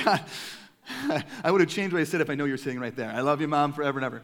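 loud, flustered, laughing apology; sheepish laughing aside to mom; laughing embarrassment. Real, not acted. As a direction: blurt it out, caught off guard. Mother spotted in audience.

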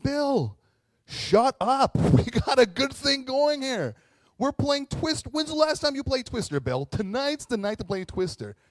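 high, loud comic scolding; hammed-up exasperated yelling at a friend; annoyed shouting. Nine lepers hushing Bill for spoiling Twister, annoyed shouting.

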